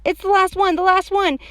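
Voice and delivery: put-on loud, high child's plea; high, insistent little-kid pleading; urgent begging. A narrator's reading. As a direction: beg urgently like an overexcited kid. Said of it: "very urgent".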